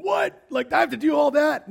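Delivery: high, loud mock disbelief; incredulous reaction. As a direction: blurt it out as the overwhelmed listener. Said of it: "This guy's going".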